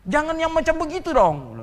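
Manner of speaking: high, loud mock protest; offended church member brushing off a question, loud; indignant retort. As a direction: act the affronted believer pushing the question away. Offended churchgoer snaps back.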